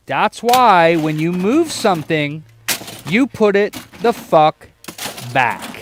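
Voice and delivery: slow, loud, exasperated lecture; fed-up scolding. Real, not acted. Scolding the crew who moved his parts, loud and exasperated. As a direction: drag it out like a fed-up boss.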